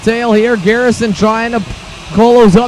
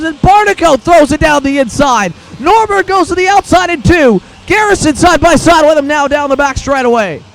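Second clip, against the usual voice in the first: motorsport commentator; 4 semitones higher than usual. Real, not acted. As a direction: call the pass with rising excitement. Live kart race, lead battle.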